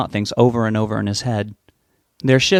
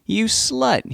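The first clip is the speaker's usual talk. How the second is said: joking tease; 12 semitones higher than usual. A narrator's reading. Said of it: "he joked".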